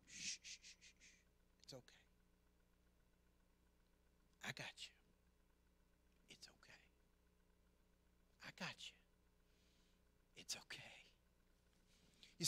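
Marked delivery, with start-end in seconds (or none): 0.1-11.1 soft shushing, almost whispered; hushed comfort. Retold or imitated. Father comforting his crying son, hushed. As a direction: soothe a crying child in a hush.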